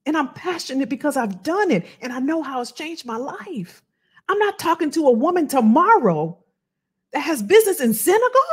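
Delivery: normal talking voice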